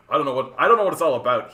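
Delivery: usual speaking voice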